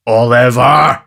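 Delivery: loud, impressive call through a keyhole; loud, important beadle calling through the door; deep impressive tone. A narrator's reading. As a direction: boom the name to frighten him. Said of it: "in a deep and impressive tone".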